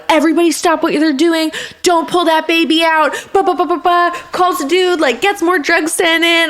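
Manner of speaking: a hint of a doctor barking orders fast; urgent anesthesiologist. Anesthesiologist halting surgery.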